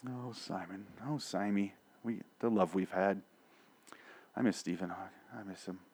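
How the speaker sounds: soft, wistful, hammed-up longing for Simon; mock mourning. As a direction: sigh it out as if missing an old love. Mock mourning a lost character.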